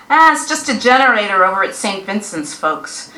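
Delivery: loud know-it-all reassurance; dismissive neighborhood expert; armchair critic. Acted. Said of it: "said the know-it-all chair".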